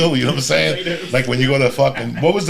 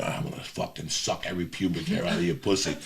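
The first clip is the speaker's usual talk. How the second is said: low leering growl; 7 semitones lower, 11 dB quieter than usual. Retold or imitated. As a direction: drop low and quiet, leering. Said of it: "his eye would roll".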